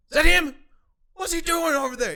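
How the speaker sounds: high, loud demanding questions; retold shouting. Employee popping his head out the door, shouting. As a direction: call out sharply, as if through a doorway.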